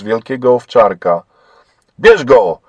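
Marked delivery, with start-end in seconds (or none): 2.0-2.6 shouted command. A narrator's reading. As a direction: set the dog on him. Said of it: "krzyknął do psa".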